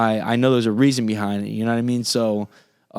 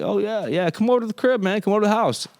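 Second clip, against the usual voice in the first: higher, casual mock-friendly invite; nonchalant invite; 8 semitones higher than usual. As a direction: toss it off like it's nothing, all easy. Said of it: "All nonchalant".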